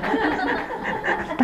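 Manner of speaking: short airy laugh at his own joke; soft breathy chuckle. Real, not acted. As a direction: let a quick breathy laugh slip out. Audience joke lands.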